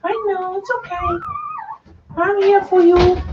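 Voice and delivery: high, tender reassurance. Real, not acted. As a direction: soothe the dog gently. Comforting the dog.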